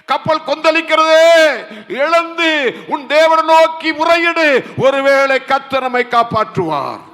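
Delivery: high, forceful rousing cry; urgent sailor. Acted. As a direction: shout the sleeper awake with stretched phrase endings. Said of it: "அந்த மாலுமி சொல்லுகிறான்". (உரக்க எழுப்பும் மாலுமி)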